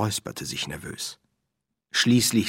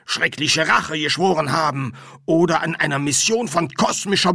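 louder, higher, emphatic explanation; brisk medium, raised voice; lecturing medium; 7 semitones higher, 5 dB louder than usual. Acted. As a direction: spell out the rules of ghosthood forcefully.

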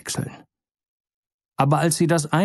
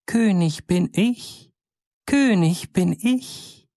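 high, slow, piping little-bird cry; fine piercing voice; 6 semitones higher, slower than usual. A narrator's reading. The tiny bird sinks down calling to all birds, high and piping. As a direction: crow it thin and shrill from above. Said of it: "mit feiner, durchdringender Stimme".

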